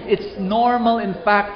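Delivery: ordinary talk, nothing marked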